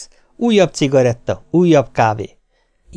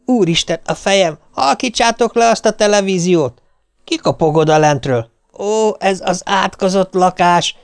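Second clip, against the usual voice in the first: higher, weary exasperated cries; exasperated outburst; 7 semitones higher than usual. Retold or imitated. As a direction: complain at the noise, head aching. Wife exasperated amid noise.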